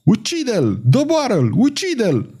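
high, frenzied yells; bay for blood like a mob; howling. A narrator's reading. The seven men in the antechamber howling for the kill. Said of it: "începură să urle".

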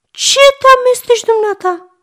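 snapping aunt. A narrator's reading. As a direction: tell the stranger off. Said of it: "zice tanti Mița urâtului". (tanti Mița îl repede pe urât, mult mai sus și mai tare)

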